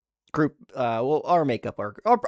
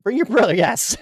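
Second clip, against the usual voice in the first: higher and louder, hyped; excited shout; 10 semitones higher, 9 dB louder than usual. Real, not acted. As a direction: shout it back with glee. Loud excited echo of the joke.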